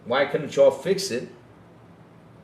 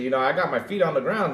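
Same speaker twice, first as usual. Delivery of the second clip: usual speaking voice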